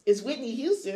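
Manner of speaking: upset protest. Retold or imitated. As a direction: insist on it, hurt. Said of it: "I'm like".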